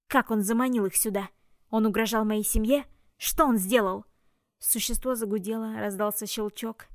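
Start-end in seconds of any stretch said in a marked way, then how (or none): 0.1-4.0 loud, enraged questions; furious. Acted. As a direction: demand answers in fury. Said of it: "щёки вспыхнули от ярости".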